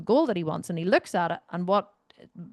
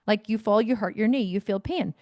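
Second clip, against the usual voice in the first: ordinary talk, nothing marked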